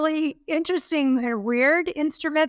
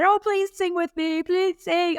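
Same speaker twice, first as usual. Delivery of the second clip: pleading parent; 6 semitones higher, slower than usual. Retold or imitated.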